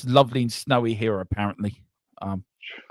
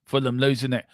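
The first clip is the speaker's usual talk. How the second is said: normal talking voice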